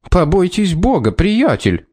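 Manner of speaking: high, strained furious outburst; berserk rage. Acted. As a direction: voice cracking with rage. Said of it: "в ярости вскричал Белмейн срывающимся от напряжения голосом".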